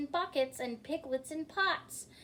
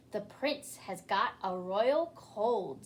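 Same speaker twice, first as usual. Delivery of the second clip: dragon voice; 2 semitones lower than usual. A narrator's reading. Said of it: "said the dragon".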